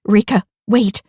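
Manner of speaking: loud urgent warning; urgent call. Acted. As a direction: call out to stop her. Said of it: "Nikki called urgently".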